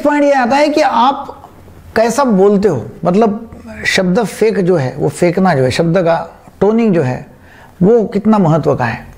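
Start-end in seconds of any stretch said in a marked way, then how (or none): none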